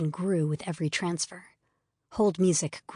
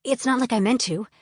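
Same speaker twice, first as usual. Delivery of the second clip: louder, higher defensive snap; snapping defensively; 5 semitones higher, 4 dB louder than usual. Acted. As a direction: shoot back, stung and defensive. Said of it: "I snapped defensively".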